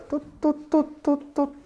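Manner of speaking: high-pitched imitated beeping tuts; rewind noise. Preacher showing years going backwards, high imitated rewind noise.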